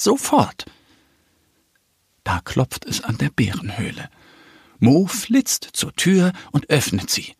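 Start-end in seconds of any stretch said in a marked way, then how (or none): none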